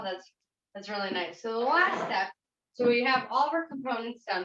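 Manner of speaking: normal talking voice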